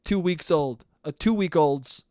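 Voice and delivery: ordinary delivery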